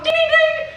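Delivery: high, loud mock ring bell; ding like the ring bell; bell imitation. Kid playing wrestling mimics the bell, high and loud.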